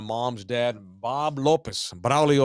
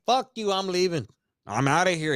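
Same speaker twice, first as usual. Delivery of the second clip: mock angry wife; 7 semitones higher than usual. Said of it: "she's like".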